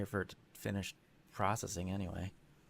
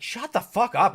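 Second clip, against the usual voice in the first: higher, louder playful fake yell; mock angry outburst; 10 semitones higher, 12 dB louder than usual. Teasing his partner about her noises, loud playful mock yell. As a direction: yell it in jest. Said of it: "Just like".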